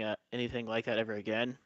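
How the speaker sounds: ordinary talk, nothing marked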